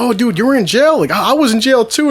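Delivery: high, excited party buddy; outgoing friendly guy. Retold or imitated. Matt bonding with Chris over jail, high and excited. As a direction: bond eagerly, pitched right up. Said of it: "Very friendly guy, very outspoken".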